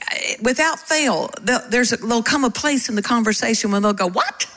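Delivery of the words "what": much higher, quick rising question; incredulous what. Acted. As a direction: imitate a startled listener. Acts out puzzled conversation partner.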